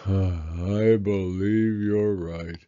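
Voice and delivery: low, slow, sighing voice; sad sigh. Acted. Eeyore finally admits his tail is gone, sighing. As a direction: let it out on a long sad sigh. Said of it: "with a long, sad sigh".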